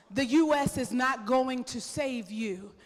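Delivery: ordinary delivery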